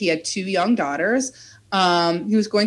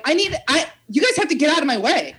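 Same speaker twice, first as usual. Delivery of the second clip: retold yelling; 6 semitones higher, faster than usual. Broke worker demanding that cops clear the way to her car, mock yelling. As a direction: imitate yelling at someone blocking the path. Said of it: "like, yelling at these cops".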